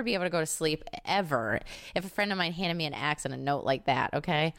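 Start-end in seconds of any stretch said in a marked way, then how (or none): none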